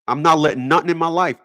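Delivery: ordinary talk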